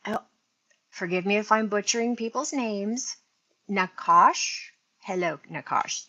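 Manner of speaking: ordinary talk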